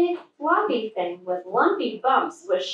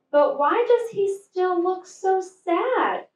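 put-on high, wondering child voice; puzzled; 9 semitones higher than usual. A narrator's reading. Fluff wonders about the sad snowman, puzzled. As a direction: ask it honestly confused. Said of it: "puzzled Fluff".

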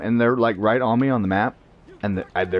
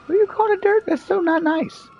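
mock offended. Gamer mocking an enemy who called him names, squeaky hurt. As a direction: play the wounded innocent, up high.

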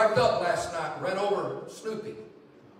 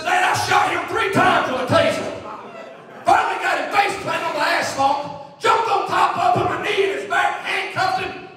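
high, shouted blow-by-blow for dad; hammed-up rookie thrilled by his wild arrest; excited son impression; 11 semitones higher, 8 dB louder than usual. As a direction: build it as a loud, high, triumphant mock report. Son's excited climax, acted for laughs.

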